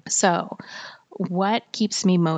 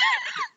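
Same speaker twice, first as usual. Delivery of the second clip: tiny high-pitched giggle; quick squeal of laughter; squeaky high laugh; 4 dB quieter than usual. Real, not acted. Laughs at a bad review.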